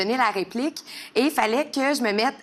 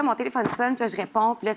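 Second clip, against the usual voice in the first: ordinary talk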